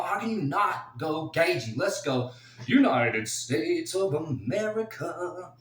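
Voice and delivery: loud, high patriotic rally call; excited hype. Real, not acted. Fan rallying for Gaethje, loud excited chant. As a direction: hype it like a cheering crowd.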